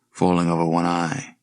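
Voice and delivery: ordinary delivery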